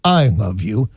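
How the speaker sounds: low, deliberate love declaration; a hardcore, low-voiced declaration; hardcore, intense. Shown as a demonstration. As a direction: drop low and say it with full weight. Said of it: "you say a hardcore".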